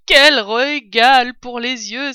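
loud, slow cry of delight; delighted exclamation. Acted. Fox dancing before a feast, exclaiming with delight. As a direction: relish every word, loudly and slowly. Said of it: "s'écria Maître Renard, sautant et dansant".

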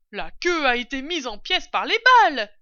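loud, high voice from above; calling down. A narrator's reading. Mistress upstairs answering about the tail, calling down. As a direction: shout the news downstairs. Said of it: "dit la voix au du dessus".

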